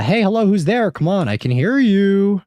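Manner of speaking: high, projected call. Pretend. Player hailing an unseen presence in the game, high calling voice. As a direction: call out high to someone hidden.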